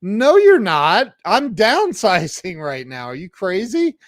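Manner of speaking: high-pitched retold disbelief; incredulous refusal. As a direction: shoot it down, voice jumping up.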